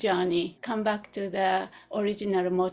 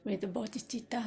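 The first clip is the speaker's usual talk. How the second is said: soft, unhurried meditation voice with long pauses; guided meditation; 6 dB quieter than usual. Real, not acted. Teacher settles students into their posture, soft guided meditation. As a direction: ease listeners gently into stillness, leaving space.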